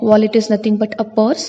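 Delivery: ordinary delivery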